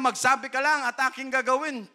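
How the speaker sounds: God granting any request, high and loud. Acted. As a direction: offer it freely as God. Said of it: "Sabi niya".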